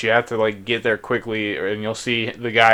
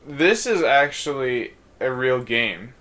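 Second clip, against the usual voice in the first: ordinary talk, nothing marked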